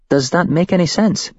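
a hint of a scared young woman; shaken woman witness. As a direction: voice her as a rattled witness telling it. Woman recounts the cult's plan.

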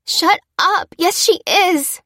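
high, loud outburst; slow, emphatic shouted denial; angry retort. A narrator's reading. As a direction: shut down the despair fiercely. Snapping back at wailing brother.

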